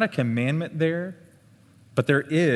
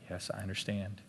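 put-on child's soft reply; 4 semitones lower, 12 dB quieter than usual.

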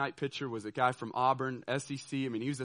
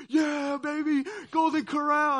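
much higher, loud mock whoop; teammates' cheer; 12 semitones higher, 6 dB louder, slower than usual. Teammates cheering the buffet stop, high loud whoop. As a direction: cheer it like the whole team. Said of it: "All my teammates are like".

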